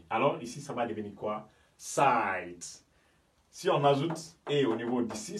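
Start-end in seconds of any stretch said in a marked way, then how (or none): 1.8-2.8 "Site" as sight, vowel drawn out; site with English I. Shown as a demonstration. Teacher reveals what it becomes, a long eye vowel. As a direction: stretch "Site" on an eye sound. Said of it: "c'est I".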